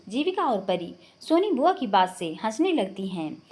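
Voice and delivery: ordinary delivery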